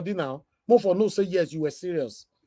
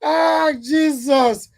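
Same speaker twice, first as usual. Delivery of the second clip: exasperated exclaim; 10 semitones higher, 7 dB louder, much slower than usual. Real, not acted. Exasperated reaction to clip.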